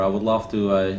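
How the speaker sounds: normal talking voice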